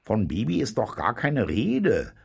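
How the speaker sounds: whining protest. A narrator's reading. Cook objects that nobody asked for the chick, whining. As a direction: whinge it with swooping emphasis. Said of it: "nölte Zausenke".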